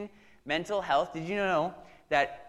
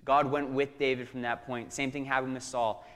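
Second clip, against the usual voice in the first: usual speaking voice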